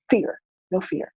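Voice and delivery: usual speaking voice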